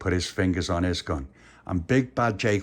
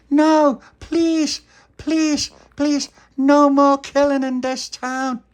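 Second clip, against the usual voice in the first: very high, louder, slow begging; shrill begging toy voice; loud high pleading; 9 dB louder, much slower than usual. Acted. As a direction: plead desperately in a squeaky voice.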